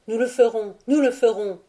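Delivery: a fervent promise shouted back from the crowd; crowd answering. A narrator's reading.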